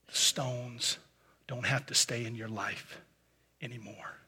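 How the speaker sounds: very soft, low, pleading voice; gentle. Real, not acted. Preacher pleads gently with his congregation to let go: very soft pleading. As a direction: plead gently, almost in a hush. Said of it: "I want to sound as gentle as I can right now".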